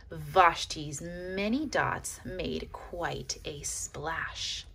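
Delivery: usual speaking voice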